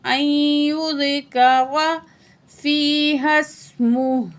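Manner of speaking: drawn-out recitation of the joined words; measured Quranic reading; slow recitation. Shown as a demonstration. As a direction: stretch each syllable as in tajweed. Recites joined word slowly.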